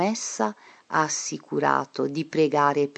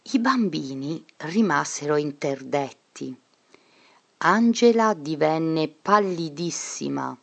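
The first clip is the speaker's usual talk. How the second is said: ordinary delivery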